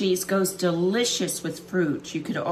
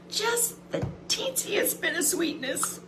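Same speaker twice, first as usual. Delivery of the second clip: high, soft and dainty; cutesy tiny voice; 11 semitones higher, 6 dB quieter than usual. Acted. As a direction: jump way up high for teensiest. Dainty word for a tiny amount.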